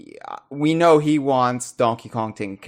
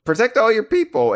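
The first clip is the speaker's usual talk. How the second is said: mock dramatic echo; 9 semitones higher, 4 dB louder, faster than usual. Echoing partner's line, mock-dramatic.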